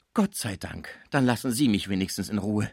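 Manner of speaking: ordinary delivery, nothing marked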